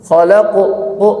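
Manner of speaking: ordinary delivery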